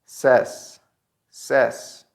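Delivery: "says" as sez, short e; third-person short vowel. Shown as a demonstration. Teacher gives third-person pronunciation, "says" as sez. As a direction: say "says" to rhyme with fez. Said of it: "The pronunciation in third person is".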